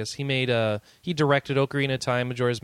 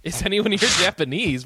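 laughing; 8 semitones higher, 5 dB louder than usual. Real, not acted. Repeating co-host's question, amused.

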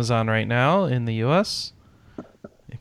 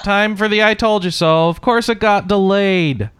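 exasperated; 8 semitones higher, 7 dB louder than usual. Real, not acted. Annoyed delay rant.

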